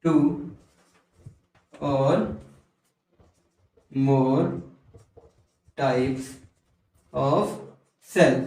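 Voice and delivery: one word at a time, long pauses; low, spaced-out dictation; slow dictation. Real, not acted. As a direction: pause after every word for writing. Dictating definition while writing it.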